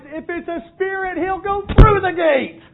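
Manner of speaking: high, loud, rising eager voice; excited realization. Acted. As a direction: suddenly catch on and get worked up. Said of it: "And they realize".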